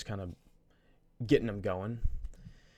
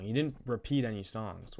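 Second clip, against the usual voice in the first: ordinary talk, nothing marked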